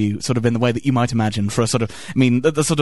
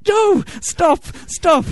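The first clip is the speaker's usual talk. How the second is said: mock anguished cry; much slower than usual. Mother begging the interviewer to stop, mock anguish. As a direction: cry out in hammed-up distress. Acted distressed reaction to interview.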